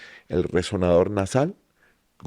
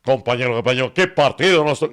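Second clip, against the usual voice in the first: louder, higher radio sports announcer; William Vinasco; 4 semitones higher, 5 dB louder than usual. Retold or imitated. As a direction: call it like a sports radio host. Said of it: "como la de William Vinasco". (imitando a William Vinasco, locutor)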